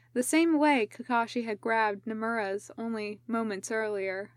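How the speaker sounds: ordinary delivery, nothing marked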